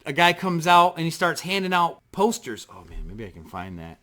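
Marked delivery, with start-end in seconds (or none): 2.7-4.0 very low, soft thinking aloud; muttered aside. Real, not acted. Storyteller to himself about finding the poster, muttered aside. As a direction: drop to a murmur to yourself.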